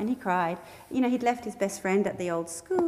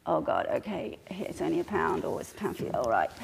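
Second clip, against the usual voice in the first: resigned sigh; 6 semitones lower, 5 dB quieter than usual. Retold or imitated. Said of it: "more familiar for me to sort of think".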